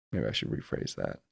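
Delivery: muttered aside. Real, not acted. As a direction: mumble it to yourself like an afterthought. Muttering self-correction to himself.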